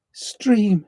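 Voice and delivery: "Stream" in a high playful voice; high mock echo of "Stream"; sing-song echo. Echoing the last word playfully.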